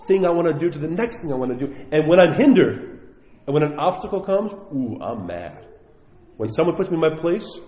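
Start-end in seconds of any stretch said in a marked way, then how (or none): 4.5-5.7 low, slow mock pout, much softer; a sulky grumble, hammed up; mock-pouting "I'm mad"; mock grumpy sulk. Mocking own anger at obstacles.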